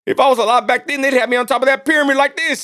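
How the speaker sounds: ordinary talk, nothing marked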